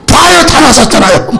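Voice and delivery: high, laughing cry of joy; laughing delight. Acted. Healed general laughing to his servants, overjoyed. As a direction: burst out laughing at the cure.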